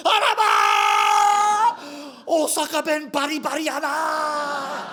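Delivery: high, loud, drawn-out shriek of amazement; astonished clerk praising his dialect; amazed shop clerk. Retold or imitated. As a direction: squeal it like a thrilled Osaka auntie.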